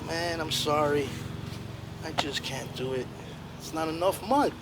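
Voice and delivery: a big bear letting them down gently; mild sorry bear. Acted. Grizzly bear gives up.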